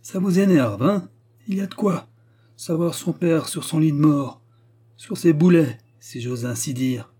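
high-pitched, needling jeer; mocking taunt. A narrator's reading. Steward taunting the vicar about his dying father, mockingly. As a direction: needle him with a mocking edge.

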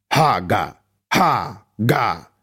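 weak strangled wheeze; pitiful wheeze. A narrator's reading. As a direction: choke the rune out pitifully. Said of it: "Хрип Нинсена вышел жалким и жалобным".

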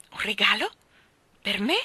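questioning Un regalo with a rise; Mary exclaims at the unexpected gift, surprised. Acted. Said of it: "esclamò Mary".

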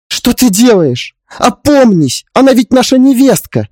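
high, loud, alarmed plea; pleading. Acted. Mother-in-law stopping her husband's hand, pleading desperately. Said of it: "Взмолилась она".

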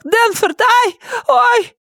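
the wife yelping in pain; cries of pain. A narrator's reading. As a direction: squeal as he pulls her hair. Myrtle in pain.

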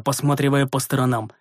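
plain reading voice

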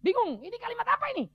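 high, loud bewildered question; baffled outburst. Acted. Acts out Nicodemus's confusion.

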